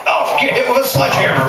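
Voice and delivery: high excited cheer. Real, not acted. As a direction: cheer it on like a fan. Host cheering on-stage violence.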